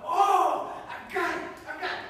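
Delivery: high, loud eureka; a boy who just figured it out; high, thrilled realization; excited discovery. Acted. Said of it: "He was like".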